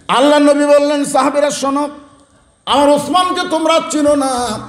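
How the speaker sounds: high, sustained preaching tone; rousing sermon. Acted. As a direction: call it out high to the companions. Preaches the Prophet's address.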